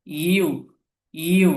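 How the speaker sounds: ordinary talk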